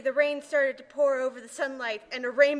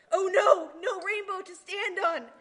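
shouting; 6 semitones higher than usual. A narrator's reading. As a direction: shout as the rainbow fades. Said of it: "shout Care Bears".

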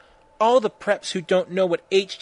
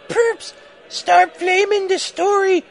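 very high, slow put-on squeal; hammed-up, stretched falsetto rant; author's note voice; 13 semitones higher, 6 dB louder, slower than usual. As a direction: draw each word out in high pitch. Acts out the shrill author's note.

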